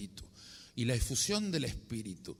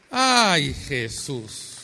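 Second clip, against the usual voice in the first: astonished cry; 11 dB louder, much slower than usual. Retold or imitated. Priest finding the same reading again, loud astonished cry. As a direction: blurt it out, stunned.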